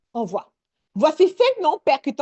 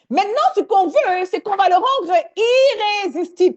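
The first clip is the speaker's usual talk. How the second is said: enthousiaste; 7 semitones higher, 5 dB louder than usual. Real, not acted. Animatrice relance son audience.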